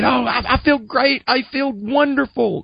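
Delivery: high-pitched, blissed-out retelling; giddy elation. Retold or imitated. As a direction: act out being on cloud nine, voice lifted high. Acting out giddy new convert.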